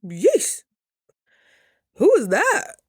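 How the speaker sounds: high, loud startled question; loud, high who-is-that; startled yeesh. Retold or imitated. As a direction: jump at a strange voice in your head. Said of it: "I said".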